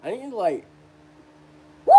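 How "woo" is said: long, high held whoop; falsetto woo. Pretend. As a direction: hold a high woo, then let it slide down.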